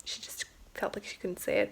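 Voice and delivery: hushed unvoiced whisper. Real, not acted. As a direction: drop to a secretive whisper. Secret she couldn't tell.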